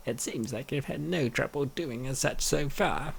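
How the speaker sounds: usual speaking voice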